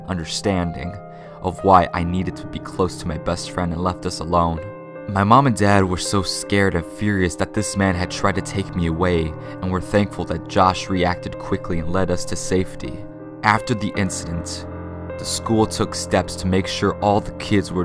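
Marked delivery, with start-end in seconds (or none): none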